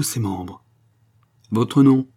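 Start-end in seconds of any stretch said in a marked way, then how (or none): 1.5-2.1 louder, higher official's question, dryly put; brusque, raised-voice questioning; curt demand. A narrator's reading. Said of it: "demanda sèchement l'officier de paix".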